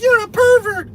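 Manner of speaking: very high, loud mock woman's voice; imitating the woman, shrill and loud. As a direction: squeal it like the woman in the bar. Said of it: "she turns around and she's like".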